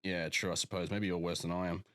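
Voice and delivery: ordinary talk, nothing marked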